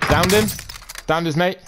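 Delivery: excited. Real, not acted. Gets two kills in a row.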